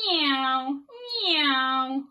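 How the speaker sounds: loud high cat meow; loud playful kitten cry; meowing baby bear. Acted. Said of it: "went Baby Bear".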